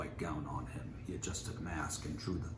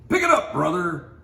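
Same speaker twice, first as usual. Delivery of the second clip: loud, much higher barked cop order; snapping cop; 12 semitones higher, 15 dB louder than usual. A narrator's reading. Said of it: "snapped a cop".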